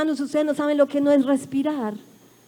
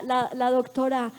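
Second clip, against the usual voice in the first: ordinary delivery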